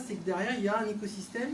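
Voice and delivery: normal talking voice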